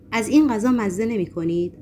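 ordinary delivery

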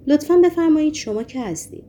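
hoarse. A narrator's reading. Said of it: "با صدایی خشدار پرسید".

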